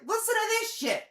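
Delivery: excited. Real, not acted. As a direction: burst out to grab everyone's ear. Launching rant about credit card.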